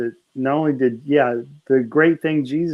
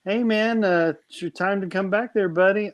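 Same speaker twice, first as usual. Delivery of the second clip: higher laid-back dad voice; casual God voice; 8 semitones higher than usual. Retold or imitated. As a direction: call him like a chill dad. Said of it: "Heavenly Father asking Jesus".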